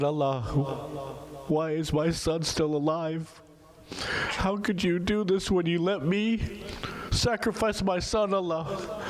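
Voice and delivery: high, slow, pleading wail to God; a grieving father's lament, slow and high; anguished plea. Retold or imitated. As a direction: cry out to God in grief.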